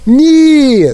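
high, loud desperate scream. Acted. Wonka screaming at Mike to stop. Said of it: "заволав містер Вонка".

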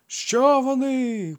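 high, loud, slow crowd cry; crowd voices. A narrator's reading. Townsfolk call back to the shrieking woman. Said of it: "пробилося одразу кілька голосів".

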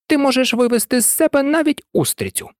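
high-pitched, exasperated retort; irritated. A narrator's reading. Crab's daughter answering her scolding mother, irritated. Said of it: "відповіла донька досить роздратовано".

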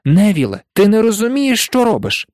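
high-pitched, urgent pleading; frustrated, despairing appeal; desperate. A narrator's reading. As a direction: plead with a stubborn friend. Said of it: "у відчаї мовив Рон".

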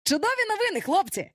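high, excited announcement; exclaiming. A narrator's reading. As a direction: exclaim it high, breaking great news. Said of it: "вигукнув він".

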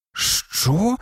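high-pitched, baffled question; mildly dumbfounded, voice lifting; stunned. A narrator's reading. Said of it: "сторопіло мовив Гаррі". (сторопіло, вищим голосом)

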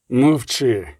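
низьке, ледь гучніше «мовчи»; обірви співрозмовницю низьким голосом; стриманий окрик. A narrator's reading. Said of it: "крикнув він". (помірно різкий наказ замовкнути)

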